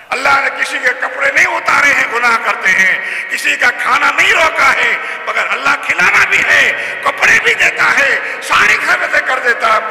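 high, loud pulpit preaching; impassioned sermon delivery; fiery preaching. Real, not acted. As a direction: preach it out at full force. Preaching at full force.

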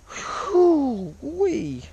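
high loud whoop; excited whoop. Real, not acted. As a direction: whoop with glee. Fly deep in throat.

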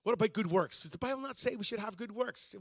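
ordinary talk, nothing marked

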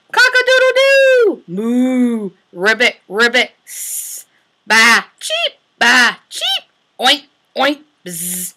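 bark, bleat and tweet each animal in turn; animal sounds. Acted. Teacher voicing farm animals for toddlers.

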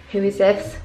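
normal talking voice

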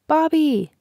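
high-pitched, falling name call; restrained yell. A narrator's reading. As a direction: yell his name, high and a touch loud. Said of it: "yelled Vanessa".

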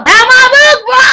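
high, loud hype yell; hollering. Shown as a demonstration. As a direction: holler it out like a street pitch. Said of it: "So I'ma holler".

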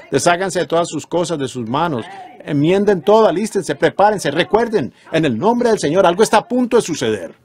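high-pitched string of pulpit commands; urgent preaching exhortation. Real, not acted. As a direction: press each command on the congregation. Exhorts the congregation. (exhortación urgente de predicador, en tono agudo)

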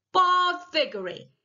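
high, slow incantation; spell-casting witch. A narrator's reading. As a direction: cast the spell in one drawn-out word.

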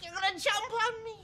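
high, panicky wail; terrified. Acted. Cowering accountant fears the man will jump on him, terrified. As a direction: wail as if about to be attacked.

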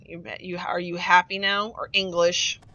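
ordinary delivery

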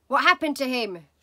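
loud, alarmed blurt; spluttered. Acted. Father alarmed by daughter's story, loud sputter. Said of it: "he spluttered".

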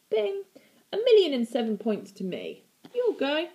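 high, gleeful gloating. A narrator's reading. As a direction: crow over the win. Gloating aunt.

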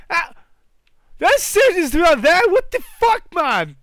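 high, loud, slowed shocked cry; high startled shout over a mess; shocked yell. Real, not acted. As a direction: react in disbelief at the mess. Shocked at scissors.

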